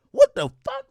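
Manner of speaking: a shrieking what the fuck; comic high disbelief; shocked squeal. Real, not acted. Disbelief at being left out.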